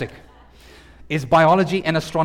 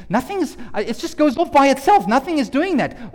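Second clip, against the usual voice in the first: neighbor's protest; 7 semitones higher than usual. Retold or imitated. Voicing the neighbor's excuse.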